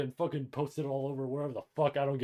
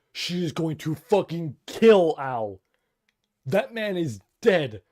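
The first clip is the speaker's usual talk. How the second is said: loud, slow and raised, hammering each word; emphatic verdict; 3 semitones higher, 6 dB louder, slower than usual. Real, not acted. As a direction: declare it loudly, drawing out the key words. Dramatic prediction about a character's fate.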